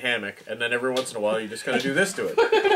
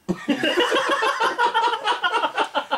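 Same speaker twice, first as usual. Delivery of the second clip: high laugh. Real, not acted. Laughing at the beer.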